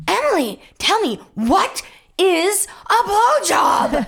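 high, slow, word-by-word shout; shouted demand. Retold or imitated. Girl corners Emily in front of class, shouting. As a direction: yell it insistently, stretching each word.

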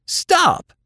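very high screamed order; piercing, urgent shout; screamed command. A narrator's reading. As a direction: cry out to halt the wagon. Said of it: "she screamed".

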